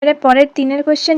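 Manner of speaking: ordinary delivery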